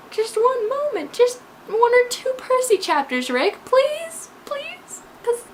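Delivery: high, whiny begging; playful pleading. Pretend. Fan begging author Rick for Percy chapters, pleading. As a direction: beg in a high voice, fading to a tiny last plea.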